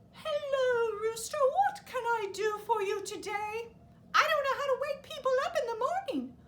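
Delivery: high, bright duck; a friendly duck greets; chirpy duck voice. Acted.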